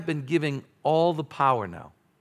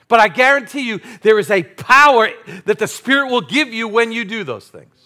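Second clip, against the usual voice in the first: loud, fired-up preaching voice; rally the congregation with full force; 5 semitones higher, 9 dB louder than usual. Acted. Pastor urging his church, full-force preaching.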